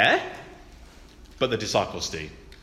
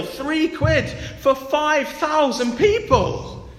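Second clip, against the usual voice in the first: much higher, mock disbelief; hammed-up outraged disciples scoffing; incredulous disciples; 10 semitones higher, 4 dB louder than usual. Disciples scoffing at Jesus' impossible request, much higher and incredulous. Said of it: "the look on the disciples' faces".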